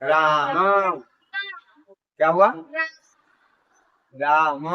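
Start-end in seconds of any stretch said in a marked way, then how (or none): 0.0-1.0 राम very slow, "raaa-maaa"; correct pronunciation. Shown as a demonstration. Teacher answering what the right pronunciation is, राम drawn out. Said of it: "सही उच्चारण क्या हुआ इसका". 4.2-4.7 राम low and drawn out as "raa-ma"; correct pronunciation. Shown as a demonstration. Teacher repeating the right pronunciation for students, राम held long. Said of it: "सही उच्चारण क्या हुआ इसका".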